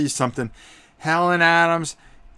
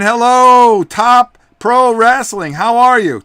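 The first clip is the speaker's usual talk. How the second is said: called-out fan greeting; 8 semitones higher, 12 dB louder, slower than usual. Acted. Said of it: "And Lord Javi saying".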